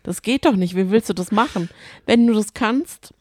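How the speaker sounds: ordinary delivery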